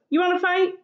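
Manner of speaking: high, pushy taunting challenge; challenging. A narrator's reading. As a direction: taunt them into a fight. Grouchy ladybug challenges.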